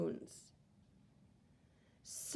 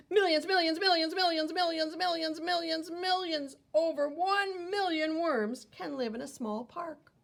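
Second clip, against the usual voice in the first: high, loud chant of millions; excited counting; 7 semitones higher, 4 dB louder than usual. Acted. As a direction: rattle off millions with glee.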